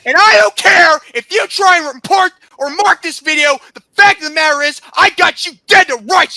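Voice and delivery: high, loud, furious rant; angry shouting. Real, not acted. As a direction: shout it as an accusation. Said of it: "I'm sorry if I'm shouting".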